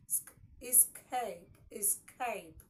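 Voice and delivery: SK alone, then slow escape; SK sound in escape. Shown as a demonstration. Teacher answering how SC is pronounced, SK shown in escape. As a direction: draw out escape after isolating SK. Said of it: "it is pronounced".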